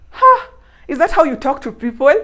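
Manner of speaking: mock outrage at his rudeness; indignant huh. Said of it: "I asked him".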